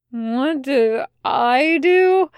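slow, groggy, confused echo; confused, hungover. Acted. Hungover demon repeating the angel's question, slow groggy confusion. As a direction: repeat it slowly like a hungover man. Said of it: "he repeated in confusion".

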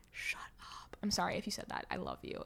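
sheepish whispered aside. Real, not acted. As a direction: whisper the apology under your breath. Aside to listener after complaining.

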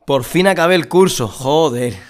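celebrating the end of the course, relieved and happy; relieved joy. Pretend. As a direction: let it out, finally done. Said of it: "Esto es alivio".

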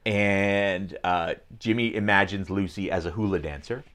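normal talking voice

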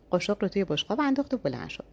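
ordinary delivery

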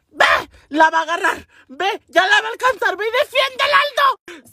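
hammed-up urgent shrieking; frantic urging. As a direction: imitate an excited girl sending him into the fight. Twin frantically eggs him on.

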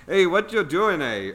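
hammed-up Irish Canadian greeting; Irish Canadian accent. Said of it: "Irish Canadian accent".

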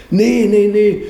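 imitating the caller's eager no; eager denial. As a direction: brush the worry off eagerly. Caller eagerly denies any problem.

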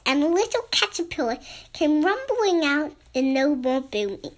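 ordinary delivery

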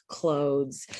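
usual speaking voice

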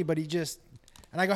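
normal talking voice

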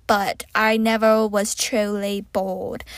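normal talking voice

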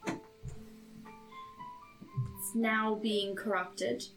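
ordinary talk, nothing marked

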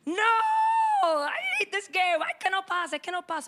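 put-on high childish tantrum; angry mock scream. Eight-year-old losing at Tetris near mom's kitchen, angry tantrum. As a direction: act out a kid's wailing game rage. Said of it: "I was so angry".

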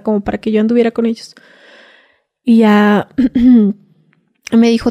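normal talking voice

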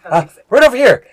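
high, loud in-character pointer; young guide. Acted. Young guide directs the party.

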